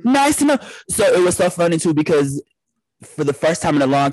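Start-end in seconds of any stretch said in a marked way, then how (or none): 0.0-0.6 sarcastic. Real, not acted. Sarcastic aside after shock.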